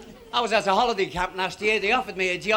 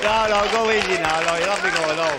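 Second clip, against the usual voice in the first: loud, quick mock protest; hushing the crowd; 6 dB louder than usual. Real, not acted. Comedian to audience, loud and quick. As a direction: wave off the applause. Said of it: "calming the laughing audience".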